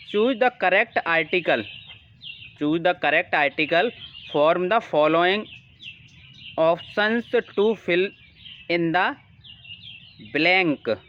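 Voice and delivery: slow, measured read of the instruction; dictation-paced reading aloud; slow question reading. Acted. Reads English instruction slowly.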